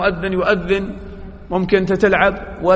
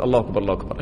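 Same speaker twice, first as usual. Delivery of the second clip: quiet repeating; 8 semitones lower, 6 dB quieter, much faster than usual. Shown as a demonstration. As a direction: lower and softer, repeating under the breath. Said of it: "تقول". (ترديد بصوت منخفض وهادئ)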